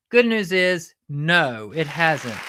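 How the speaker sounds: usual speaking voice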